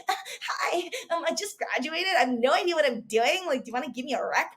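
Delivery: put-on high, hurried valley-girl grad; clueless new grad. Shown as a demonstration. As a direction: play the nervous rookie calling a client. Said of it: "I'm not gonna be like".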